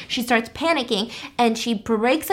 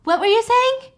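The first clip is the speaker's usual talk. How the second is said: dazed friend; 11 semitones higher than usual. Retold or imitated. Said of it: "And she's like".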